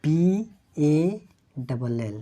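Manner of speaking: drawn-out letter names; slow spelling. Shown as a demonstration. Teacher spells the word slowly for students. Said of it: "तो इसको पढ़ेंगे".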